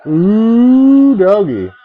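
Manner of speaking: loud, high excited whoop; hype exclamation. Pretend. Hyping before a roast rhyme.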